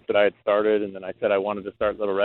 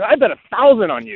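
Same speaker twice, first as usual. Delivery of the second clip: retold excited shout; 11 semitones higher, 7 dB louder than usual. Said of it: "He said".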